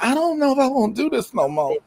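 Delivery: high-pitched reluctant whine; mock whining. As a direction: whimper like someone ready to quit. Retelling second thoughts after joining workout.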